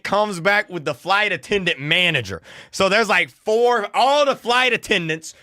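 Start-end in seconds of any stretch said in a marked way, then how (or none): none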